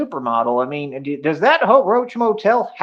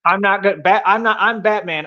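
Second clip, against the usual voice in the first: usual speaking voice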